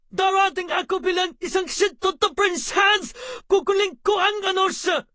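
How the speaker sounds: stern, shouted scolding; harsh telling-off at full voice; angry scolding. Acted. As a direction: scold forcefully, hammering out each order.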